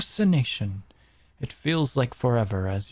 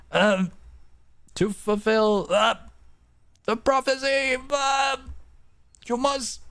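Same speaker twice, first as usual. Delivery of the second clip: straining through a spicy throat; 9 semitones higher than usual. Pretend. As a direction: push out the prophecy, strained. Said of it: "some spice stuck in my throat".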